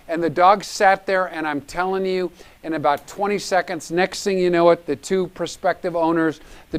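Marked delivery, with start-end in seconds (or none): none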